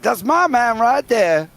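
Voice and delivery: slow, high southern American drawl on the quote; quoting a viewer's chat message in a southern American accent. Retold or imitated. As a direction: put on a southern American twang for the line. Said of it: "I can only do the accent when I do that line".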